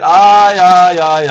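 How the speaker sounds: loud Aye, aye, aye, hammed up; loud mock Spanish cry. A mock Spaniard loudly crying out about his sombrero. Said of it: "I said".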